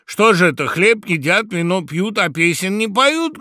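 high, loud rhythmic call; loud rousing complaint; sudden shout. A narrator's reading. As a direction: cry out a challenge to the feast. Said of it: "крикнул вдруг ворон".

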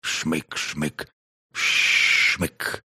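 sniffing sound. A narrator's reading. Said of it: "проскрипела рассказчица".

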